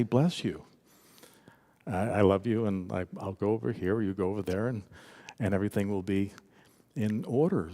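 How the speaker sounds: soft, low, soothing send-off; hushed, calm goodwill; gentle blessing. Acted. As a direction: bless them quietly and let them go. Models blessing someone who dislikes you.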